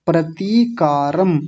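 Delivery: ordinary talk, nothing marked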